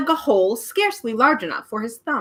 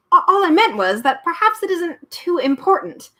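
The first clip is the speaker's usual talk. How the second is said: put-on higher, stammering polite boy; polite hesitant boy; 9 semitones higher, 3 dB louder than usual. A narrator's reading. Milo politely backing off, hesitant. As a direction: tread carefully, stumbling at the start. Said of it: "trying not to be impolite".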